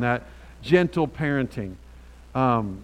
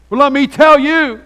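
sudden hammed-up shout, much higher and louder; mock yell; 13 semitones higher, 17 dB louder than usual. Preacher mocking a harsh parent to the congregation, hammed-up shout. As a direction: blast it like a parent losing it.

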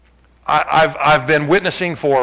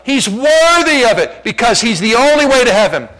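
higher, emphatic pulpit proclamation; impassioned preaching; 6 semitones higher, 4 dB louder than usual. Real, not acted. Preacher urges church that Jesus is worth ridicule, impassioned. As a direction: preach it with rising force, leaning on key words.

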